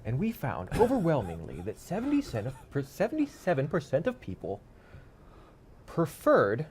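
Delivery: hammed-up data presentation; mock TED speaker. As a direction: parody a TED Talk with weighty pauses. Improvised mock TED Talk speech.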